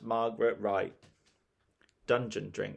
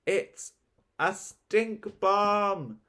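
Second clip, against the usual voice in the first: high, drawn-out cry of alarm; horrified storybook shriek; screamed alarm; 10 semitones higher, 3 dB louder, much slower than usual. A narrator's reading. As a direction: scream it slowly, pitched way up. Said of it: "screamed Margaret".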